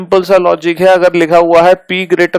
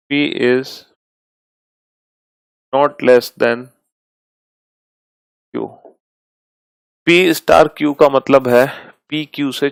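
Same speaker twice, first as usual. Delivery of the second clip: slow, quieter dictation with long pauses; 3 semitones lower, 5 dB quieter, slower than usual. Shown as a demonstration. Teacher dictates new symbol rules slowly for the class to note. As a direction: dictate each rule slowly for students to copy.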